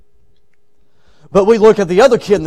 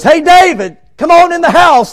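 raised, high call to someone outside; 8 semitones higher, 5 dB louder than usual. Acted. Someone summoning David from out back, calling out. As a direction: holler out to fetch him indoors.

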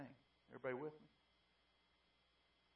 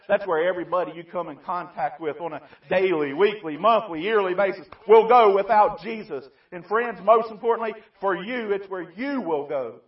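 louder and higher with each phrase; building sermon climax; 6 semitones higher, 16 dB louder than usual. Acted. Preacher warning the congregation where everyone goes, building loud. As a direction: climb steadily toward the point.

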